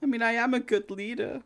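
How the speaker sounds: put-on high, indignant boy voice; defensive boy. Boy leader defends himself, high and indignant. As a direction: insist like a hurt kid.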